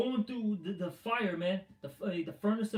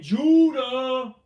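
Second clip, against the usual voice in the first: louder and higher, a sudden mocking shout of the name; mock scream; 6 semitones higher, 12 dB louder than usual. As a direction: yell it like a street preacher in fringes.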